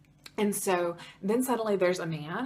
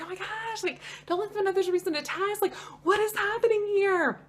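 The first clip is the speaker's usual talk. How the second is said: much higher, panicky inner voice; mock panic; 13 semitones higher, 3 dB louder than usual. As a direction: squeak it out in rising alarm. Panicking as husband offers test.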